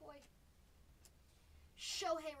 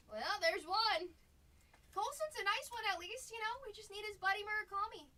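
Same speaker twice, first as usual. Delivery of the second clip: louder and higher, thrilled at the pull; excited; 8 semitones higher, 11 dB louder than usual. Real, not acted. Hyped collector cheering a good card from the pack. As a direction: cheer the card you just pulled.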